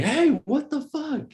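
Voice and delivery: high, slow startled what the fuck; retold outburst. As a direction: blurt it in shocked disbelief. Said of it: "closest I've ever got to sincerely".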